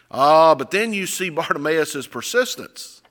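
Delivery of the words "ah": ordinary talk, nothing marked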